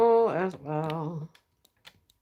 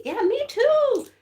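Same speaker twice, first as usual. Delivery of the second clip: excited agreement; 13 semitones higher, 7 dB louder than usual. Real, not acted. Viewer is working on purple too.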